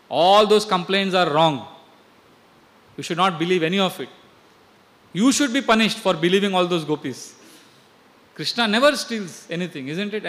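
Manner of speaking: a hint of a stern father; firm fatherly defence. Nanda defends Krishna.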